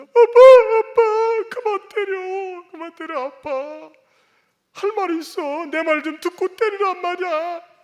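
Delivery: put-on high, very loud wailing child begging; child begging his father to stop hitting him, crying; crying child's plea. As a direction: scream for daddy to stop through tears. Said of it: "울음 섞인 소리로".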